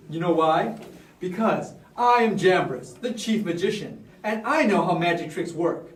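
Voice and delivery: usual speaking voice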